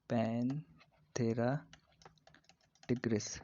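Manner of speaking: Panthera in long, separated parts; slow dictation. Shown as a demonstration. Teacher repeats tiger's genus for students, slowly split. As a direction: pause within Panthera, stretching it.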